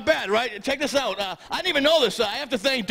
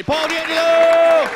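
long drawn-out loud introduction call; announcer call; 7 semitones higher, 8 dB louder, much slower than usual. Real, not acted. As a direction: stretch the name out like a ring announcer. Host announcing the comedian.

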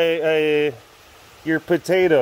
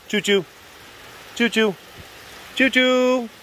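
playful choo-choo climbing higher; train noise; 9 semitones higher than usual. Real, not acted. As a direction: call out like a toy train.

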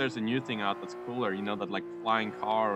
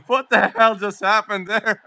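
laughing disbelief; 9 semitones higher, 10 dB louder, slower than usual. Real, not acted. Laughing in disbelief after win.

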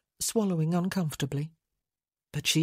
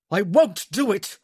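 loud, desperate refusal; yelling; 3 semitones higher, 8 dB louder than usual. A narrator's reading. Man refusing the woman as he shakes her, yelling. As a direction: yell it out in refusal. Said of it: "he yelled".

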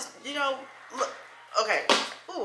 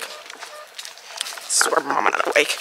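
slow hushed whisper; 10 semitones lower, 9 dB quieter, much slower than usual. Real, not acted. Creeping into the house, whispering slowly. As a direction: whisper so mama stays asleep.

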